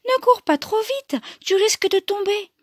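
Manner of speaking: high-pitched, kindly Mama Bear; gentler mother bear. Acted. Mama Bear cautioning the fleeing girl, gentle high voice. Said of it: "Plus douce".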